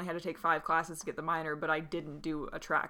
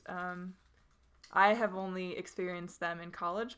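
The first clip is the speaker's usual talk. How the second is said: ordinary delivery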